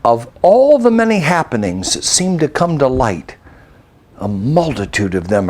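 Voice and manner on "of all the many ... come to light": ordinary delivery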